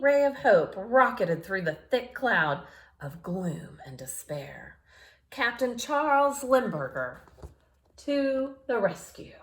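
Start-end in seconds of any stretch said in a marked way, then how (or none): none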